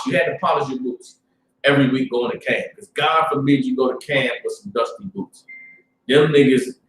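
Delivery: usual speaking voice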